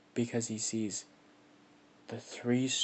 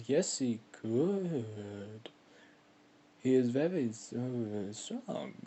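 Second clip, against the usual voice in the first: slow, strange beast voice, rising on strong; slow creature voice; much slower than usual. A narrator's reading. Beast answering the god, slow and odd-voiced. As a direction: drawl it like a wary old beast. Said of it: "it's like".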